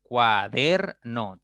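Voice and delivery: "Cuaderno" very slowly as cua - der - no; syllable by syllable. Shown as a demonstration. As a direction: draw "Cuaderno" out in three separate beats, cua, der, no. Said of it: "Tres sílabas".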